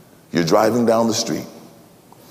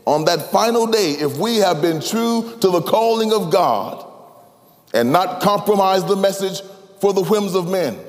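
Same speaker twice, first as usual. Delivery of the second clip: raised, ringing sermon voice; impassioned preaching; 7 semitones higher than usual. Acted. Pastor preaching to his ministers, with full fervor.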